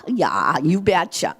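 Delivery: hammed-up Minnesota accent with long drawn vowels. Shown as a demonstration. Director showing the audience her old home-state twang, Minnesota accent. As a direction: put on a folksy Minnesota accent. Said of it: "I can do the accent to Minnesota".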